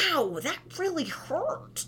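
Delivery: high, slow playful ow; mock ow. Mom jokes with hurt child, high slow playful ow. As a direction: make light of a bump.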